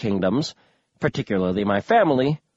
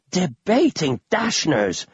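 put-on slow, drawn-out old man's exclamation; exclaimed; 3 semitones higher, slower than usual. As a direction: call it out like a dotty old grandpa. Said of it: "Grandpa Smedry exclaimed".

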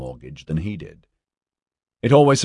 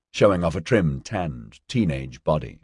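usual speaking voice

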